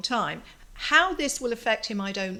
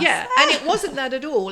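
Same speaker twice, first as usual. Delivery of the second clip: louder, laughing through the words; 5 semitones higher, 9 dB louder than usual. Real, not acted. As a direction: laugh it out at yourself. Laughing at her own mistake.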